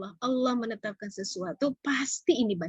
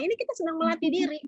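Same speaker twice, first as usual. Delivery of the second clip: ordinary talk, nothing marked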